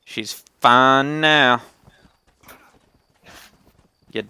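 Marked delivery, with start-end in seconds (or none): 0.0-1.6 slow, louder declaration; loud slow echo. Acted. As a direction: say it out boldly and slowly.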